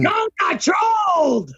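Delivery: slow, drawn-out gloating yell; taunting shout. Real, not acted. Gloating at the internet, slow shout. Taunting fans about troll.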